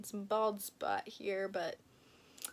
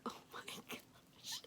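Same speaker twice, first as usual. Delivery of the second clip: hushed, overwhelmed whisper; barely-there gasp of wonder; awed whisper; 17 dB quieter than usual. Real, not acted. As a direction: whisper it in disbelief. Overwhelmed by the gift.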